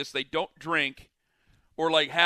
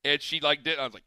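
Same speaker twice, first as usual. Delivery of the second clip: ordinary talk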